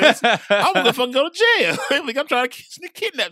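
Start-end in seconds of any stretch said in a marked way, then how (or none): 0.0-1.8 high, loud, laughing mock panic. Cousin trailing the boy to the park, laughing mock panic. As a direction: laugh it out in disbelief.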